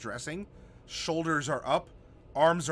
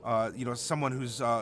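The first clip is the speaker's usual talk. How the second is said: usual speaking voice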